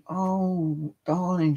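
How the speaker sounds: softly imitating a fussing shop supervisor; gushing supervisor. Supervisor soothing the embarrassed customer, gushing.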